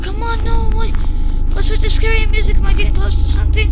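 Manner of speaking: loud, fast alarmed questions; anxious panic. Real, not acted. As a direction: rattle off frightened questions. Scary music, anxious.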